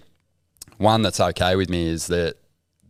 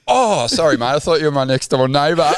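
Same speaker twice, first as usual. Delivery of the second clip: higher, louder imitated apology; mock apologetic realisation; 8 semitones higher, 6 dB louder than usual. Bloke apologising to supposed neighbour, exaggerated sudden realisation. Retold apology for mistaken identity.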